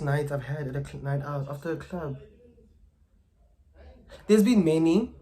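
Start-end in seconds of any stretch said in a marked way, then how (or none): 4.3-5.1 excited exclamation. Real, not acted. Exclaims about crazy nights.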